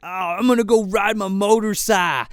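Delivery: hammed-up drunk young guy; whiny drunk voice, lightly voiced; mock drunk whine. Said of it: "He's like".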